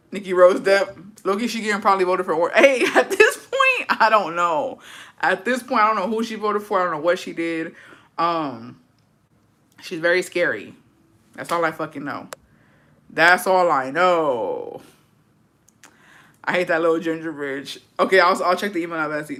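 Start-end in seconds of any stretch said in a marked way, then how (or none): 2.5-4.8 laughing. Real, not acted. Laughing high. 13.1-14.8 slow. Real, not acted. Slow drawn-out.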